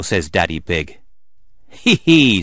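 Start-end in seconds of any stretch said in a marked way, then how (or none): 1.7-2.4 teasing giggle. Acted. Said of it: "says Mummy Pig".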